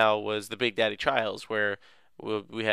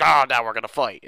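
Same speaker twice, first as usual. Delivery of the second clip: louder mock challenge; mock battle cry; 7 dB louder than usual. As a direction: call out the fight loudly. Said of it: "Was like".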